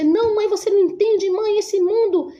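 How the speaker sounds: high, strained pleading; desperate son. Retold or imitated. Son desperately answering his mother, high and strained. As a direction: cry out that she doesn't understand.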